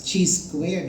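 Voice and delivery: CHI said as "chee", like cheese; "chee" square, with a ch sound; common mispronunciation. Shown as a demonstration. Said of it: "it is pronounced as".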